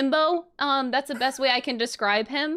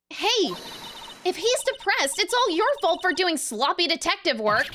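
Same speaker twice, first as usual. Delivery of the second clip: put-on scolding girl voice; 9 semitones higher than usual. Heroine scolds detective.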